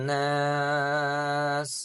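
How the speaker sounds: measured Quranic recitation. Acted. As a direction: recite slowly with long drawn vowels. Recites the ayah's ending word.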